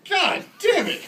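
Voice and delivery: high, loud mock-frustrated yell; hammed-up cry of exasperation; exasperated outburst. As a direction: burst out in comic despair. Retold exasperated reply to mother.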